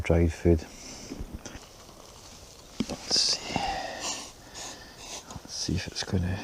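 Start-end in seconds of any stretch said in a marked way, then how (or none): 2.9-6.4 hushed whisper. Real, not acted. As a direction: breathe the words, barely voiced. Quietly checking the food.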